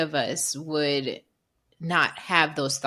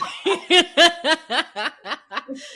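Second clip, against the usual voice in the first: hearty laugh; 10 semitones higher, 5 dB louder than usual. Real, not acted. Laughing at own joke.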